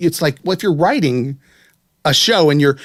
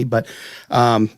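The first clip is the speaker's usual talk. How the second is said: normal talking voice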